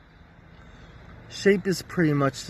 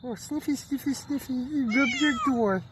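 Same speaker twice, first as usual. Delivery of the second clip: pet talk; 7 semitones higher, 3 dB quieter than usual. Real, not acted. Cooing to the cat.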